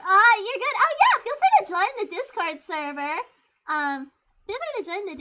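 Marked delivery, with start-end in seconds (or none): 0.0-0.8 higher, loud burst of excitement; loud and hyper. Real, not acted. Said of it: "I get very loud when I'm hyper". 0.8-3.2 higher, excited shout; loud and hyper. Real, not acted. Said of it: "I get very loud when I'm hyper".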